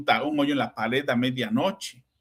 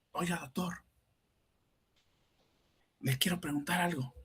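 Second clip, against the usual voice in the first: hushed, careful softer asking; soft little voice; 9 dB quieter than usual. Retold or imitated. Father to his analyst, hushed so the sleeping baby won't wake. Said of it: "así en voz suavecita".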